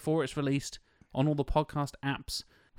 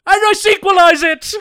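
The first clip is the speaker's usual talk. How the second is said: triumphant shout; 17 dB louder than usual. Pretend. Triumphant punchline to movie quote.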